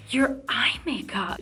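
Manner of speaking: slow, breath-only admiring whisper; awed whisper. Retold or imitated. Singer admiring a fan's makeup, in an awed whisper. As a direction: imitate someone struck by what they see.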